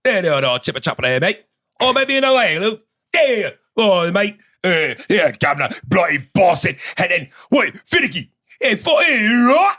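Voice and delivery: put-on sling British slang in a jokey British accent; teasing imitation of a British accent; mock British accent. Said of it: "them accents".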